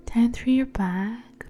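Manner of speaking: usual speaking voice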